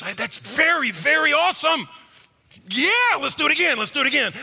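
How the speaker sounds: high, bubbly praise like to a puppy; gushing praise. Retold or imitated. Wife praising husband like puppy.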